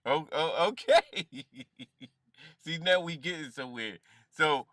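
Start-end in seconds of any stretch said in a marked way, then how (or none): 0.0-1.5 real laugh breaking through; amused delight. Real, not acted. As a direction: stammer into a tickled, rising laugh. Reactor delighted at the clip.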